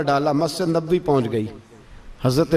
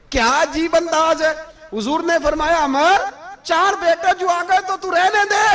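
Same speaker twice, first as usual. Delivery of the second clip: high, ringing retold dialogue; impassioned preaching; 11 semitones higher than usual. Preacher retelling an offer of sons for battle, impassioned. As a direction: carry the quoted exchange in a raised preaching pitch.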